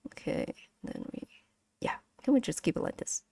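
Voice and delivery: unvoiced whisper; quick hushed aside. Real, not acted. Aside while muting audio.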